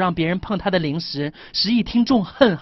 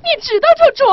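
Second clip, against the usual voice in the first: very high, loud nagging wife voice; shrill scolding wife; 5 dB louder, faster than usual. Acted. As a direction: squeal it like a bossy wife. Still voicing the wife.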